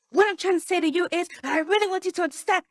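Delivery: rushed, high-pitched gabble; too fast. Acted. Teacher acting a speaker who loves the topic, too fast. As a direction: race through it, pitch way up, eager to get it across. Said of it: "because you're too fast".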